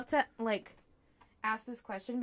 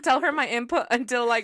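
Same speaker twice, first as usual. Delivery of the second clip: ordinary talk, nothing marked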